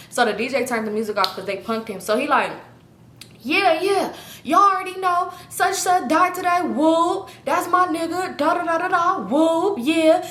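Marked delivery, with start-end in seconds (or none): 3.4-10.2 higher, hyped party-mic shoutout; mic hype man. Retold or imitated. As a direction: hype the crowd like an MC. Hyping crowd on the mic.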